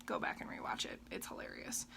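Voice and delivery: soft whispered aside; whispering. Real, not acted. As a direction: whisper it conspiratorially. Whispered aside about earlier mishap.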